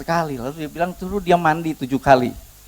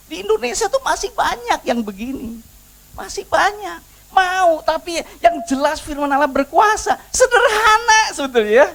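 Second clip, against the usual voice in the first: exasperated preaching; 14 semitones higher than usual. Real, not acted. Preacher lamenting what is common in Indonesia, high and emphatic. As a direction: press the complaint home.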